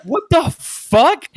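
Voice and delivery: drawn-out, high, loud disbelief; stunned disbelief. Retold or imitated. Retelling his reaction to the game comments, baffled outburst.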